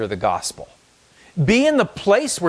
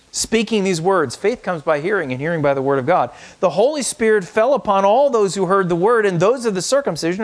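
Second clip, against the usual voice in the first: raised, proclaiming scripture voice; scripture reading; 6 semitones higher than usual. Acted. Pastor reading scripture aloud to the congregation, proclaiming. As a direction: read the verse out as a proclamation.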